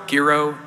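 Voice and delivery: "gyro" said ghee-ro, with a hard g and a short i; ghee-ro pronunciation. Shown as a demonstration. Panelist lists the options for a ruling, hard-g ghee-ro. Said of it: "How, how is it?".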